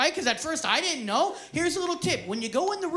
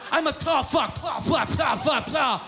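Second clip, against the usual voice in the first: mock panicked cursing; 3 dB louder than usual. Retold gagging panic, shouted.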